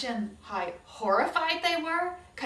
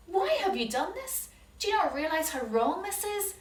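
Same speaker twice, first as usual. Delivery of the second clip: higher, pointed accusation; accusing servant; 8 semitones higher, 4 dB louder than usual. Acted. Servant catching the brothers, accusing. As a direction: confront the thieves.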